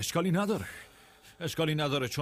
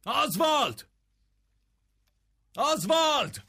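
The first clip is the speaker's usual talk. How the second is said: calling out; 7 semitones higher than usual. Acted. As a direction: shout the name to summon someone. Calling out for missing man.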